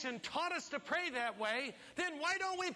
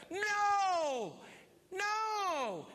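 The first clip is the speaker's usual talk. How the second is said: loud, higher double denial; emphatic shout; 8 semitones higher, 5 dB louder than usual. Real, not acted. Preacher rejecting hopeless talk, loud shout.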